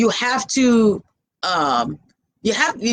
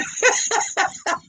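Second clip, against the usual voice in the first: airy laugh through the nose; soft breathy laugh; 12 semitones higher, 5 dB quieter than usual. Real, not acted. Laughs at long phone calls.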